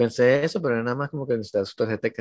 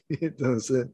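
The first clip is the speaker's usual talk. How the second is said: usual speaking voice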